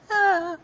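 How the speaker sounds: high, loud little yelp; mock scared yelp. Storyteller voicing startled characters, high mock yelp. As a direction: give a short high pretend yelp. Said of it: "they get scared".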